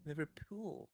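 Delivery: soft, high, drawn-out Liverpudlian attempt; imitated Liverpudlian accent. American copying his friend's demo, tentative Liverpudlian accent. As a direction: copy the Liverpudlian accent slowly and quietly. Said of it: "That's a Liverpudlian accent".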